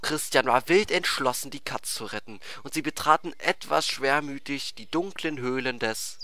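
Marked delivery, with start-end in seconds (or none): none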